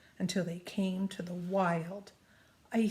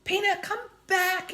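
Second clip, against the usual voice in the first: high, loud call after the mouse; 11 semitones higher, 7 dB louder than usual. Acted. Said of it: "Annie called".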